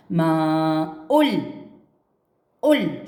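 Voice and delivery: ماء stretched very slowly then ال cut off and repeated alone; broken-off ال. Shown as a demonstration. Teacher isolates ال after ماء for pupils. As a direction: break the word at ال and stress it. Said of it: "مكتوب ولكن ما تقرأ".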